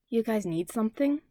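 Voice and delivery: ordinary delivery, nothing marked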